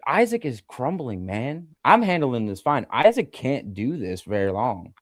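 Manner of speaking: ordinary delivery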